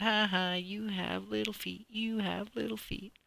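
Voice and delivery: high, sing-song schoolyard jeer; mocking taunt. Bully dinosaurs taunting the blue dinosaur, mocking sing-song. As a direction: tease him like a bully kid.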